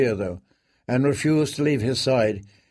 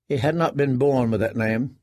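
ordinary delivery, nothing marked

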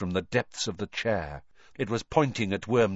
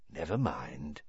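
much softer, cold never mind trailing off; cold murmur; 5 semitones lower, 10 dB quieter than usual. A narrator's reading. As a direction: let it drop to a cold murmur. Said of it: "said the cold voice".

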